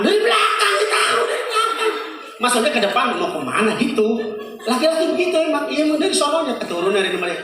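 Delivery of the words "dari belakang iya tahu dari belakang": high exasperated jab; mock the pointless answer; annoyed wife mocking the husband.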